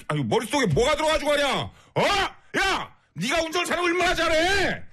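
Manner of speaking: hammed-up furious telling-off with sudden shouts; mock angry rant. On a listener's request, chewing out a rude male driver in a hammed-up angry rant. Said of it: "화 좀 내주세요".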